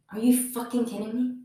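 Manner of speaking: ordinary talk, nothing marked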